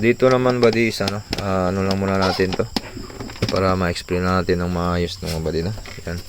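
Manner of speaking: usual speaking voice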